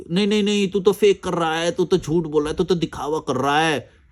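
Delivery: a mocking, whiny take on a commenter; mocking critic. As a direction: parody the heckler shouting you down.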